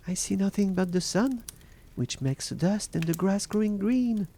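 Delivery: high-pitched voice of the watching sister; Sister Anne. A narrator's reading. Sister Anne reporting from the tower, high-voiced reply. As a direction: answer from above in a high voice.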